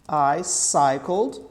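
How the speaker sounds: slow, drawn-out "I cycled"; careful cycled. Shown as a demonstration. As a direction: say "cycled" slowly, silent E. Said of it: "no cycled".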